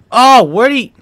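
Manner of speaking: usual speaking voice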